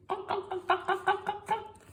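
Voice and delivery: high quick clucks; hen clucking. Acted. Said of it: "said the hens".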